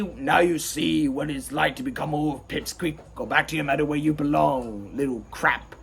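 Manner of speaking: low, dismissive wolf voice; big wolf putting down a pup; gruff wolf role. Acted. Wolf scolds the little sheep.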